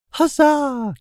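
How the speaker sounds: put-on very high, girlish little cheer; giggly enchantress cheer. A narrator's reading. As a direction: cheer gleefully in a high woman's voice. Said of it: "giggled the enchantress".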